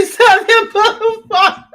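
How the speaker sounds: high, loud, cracking up while reading; laughing. Real, not acted. Streamer reads a viewer's comment about Montgomery streets, laughing. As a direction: break up laughing halfway through reading it out. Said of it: "I'm laughing at that".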